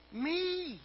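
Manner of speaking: put-on very high, soft little-child voice; whispering child with a giggle. Child confessing to the boss at the punchline, hushed and gleeful. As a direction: imitate a gleeful child sharing a secret. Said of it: "with a giggle".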